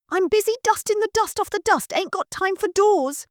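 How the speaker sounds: put-on high, common-girl maid voice; lazy servant talking back; cheeky maid. Maid answers from upstairs.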